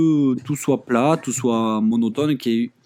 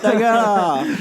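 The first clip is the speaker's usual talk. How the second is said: high, loud drawn-out retort; mock outburst; 9 semitones higher, 5 dB louder, much slower than usual. Heckling the tourist in the anecdote, mock yell. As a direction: yell it back in jest.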